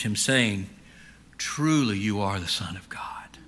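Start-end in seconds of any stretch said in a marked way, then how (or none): 1.4-3.4 hushed, awed whisper. Acted. Disciples whispering in awe after the storm. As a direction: breathe it in reverent awe.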